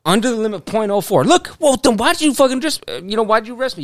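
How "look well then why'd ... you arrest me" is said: indignant. Retold or imitated. Indignant retelling of arrest.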